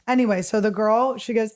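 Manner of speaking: ordinary talk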